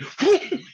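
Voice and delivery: high, short delighted laugh; burst of laughter. Real, not acted. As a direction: laugh out in sudden glee. Delighted after knocking kid down.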